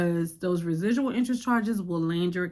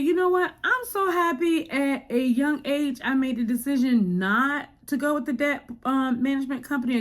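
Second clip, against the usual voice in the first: excited; 7 semitones higher, 4 dB louder than usual. Retold or imitated. Inner voice, excited relief.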